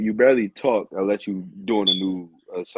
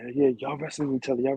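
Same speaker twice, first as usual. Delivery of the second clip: quick, higher-pitched eager chatter; imitated excitement; 5 semitones higher, 3 dB quieter, faster than usual. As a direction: imitate an excited crowd backstage, words tumbling out fast. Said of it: "everybody excited over there".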